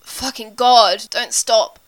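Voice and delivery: loud, slow drawn-out groan. A narrator's reading. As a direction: groan it out urgently. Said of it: "Frank groans".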